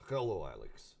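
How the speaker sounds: Russian throaty H on hello; mock Russian accent. Streamer greeting a game character, mock Russian accent.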